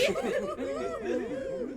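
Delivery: put-on high old hag cackle; witch cackle.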